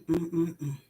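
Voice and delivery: normal talking voice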